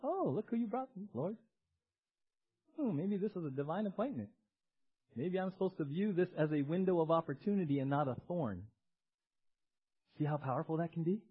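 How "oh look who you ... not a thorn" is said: soft, acted dawning realization; hushed pondering after an outburst; kairos realization. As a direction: muse it quietly, as if reconsidering. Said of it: "Or kairos".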